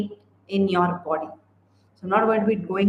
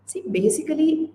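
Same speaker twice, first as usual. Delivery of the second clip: usual speaking voice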